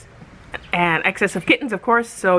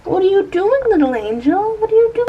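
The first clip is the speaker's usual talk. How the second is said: high, sing-song coo; 11 semitones higher, 4 dB louder than usual. Real, not acted. Talking to the puppy in a high coo. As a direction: coo to the little angel.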